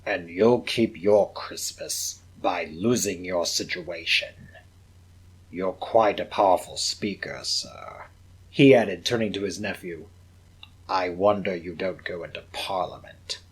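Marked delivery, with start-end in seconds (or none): 0.0-8.1 low, slow, sour voice; mock him with cold contempt; sneering old miser. Scrooge sneering at his nephew, low and sour. 10.9-13.4 mock him with cold contempt; sneering old miser. Scrooge sneering at his nephew, low and sour.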